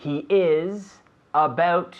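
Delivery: teacher's slow model; slow example. Shown as a demonstration. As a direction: stretch the example out. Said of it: "slow example sentence".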